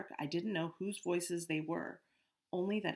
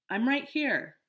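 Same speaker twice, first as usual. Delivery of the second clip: high, loud call; 8 semitones higher, 10 dB louder, slower than usual. Acted. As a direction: call back across the dark. Calling out in dark.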